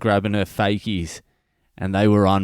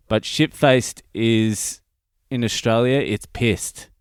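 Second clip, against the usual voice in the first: slow explaining; slower than usual. Shown as a demonstration. Host slowly explaining slang to international listeners. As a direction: stretch "shit-faced" out slowly. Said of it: "for our international listeners".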